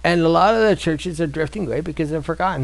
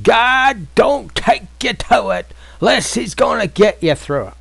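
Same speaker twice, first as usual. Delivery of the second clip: higher, louder, slow preacher's delivery; T D Jakes; 6 semitones higher, 5 dB louder, slower than usual. Retold or imitated. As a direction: intone it like a big-church preacher. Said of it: "he has this expression".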